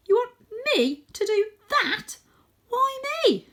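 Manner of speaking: high, tearful protest; young cat begging not to go; frightened Tam. Acted. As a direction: plead in a wavering high voice.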